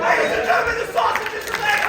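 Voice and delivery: loud, high hype-man call; announcer shout. Acted. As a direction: boom it out like a ring announcer. Announcing a comedy show act.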